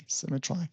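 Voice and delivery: "Symmetry" ending like "try"; quiet aside, "Symmetry" rhymed with "eye"; rhyming pronunciation. Shown as a demonstration. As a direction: say "Symmetry" with a final "eye" sound, softly.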